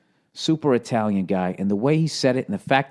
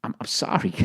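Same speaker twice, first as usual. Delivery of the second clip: soft, higher-pitched stammer; stammered apology; 5 semitones higher, 7 dB quieter than usual. Acted. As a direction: apologize meekly to a furious mobster. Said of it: "he just keeps going".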